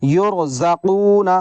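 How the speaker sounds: یرزقون drawn out very slowly with a heavy ra; slow Quranic recitation of یرزقون. Shown as a demonstration. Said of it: "را ساکن سے پہلے پیش ہے".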